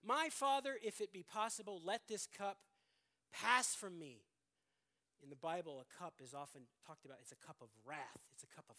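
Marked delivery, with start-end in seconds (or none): 0.1-4.2 loud, fervent prayer in a raised voice; Jesus praying in the garden. Acted. Jesus pleading with his Father, loud and fervent. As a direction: cry out the plea to the Father. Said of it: "he prayed, saying".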